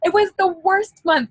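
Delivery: near tears, pushing it out; real emotional outburst; overwhelmed. Real, not acted. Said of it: "I'm shaking".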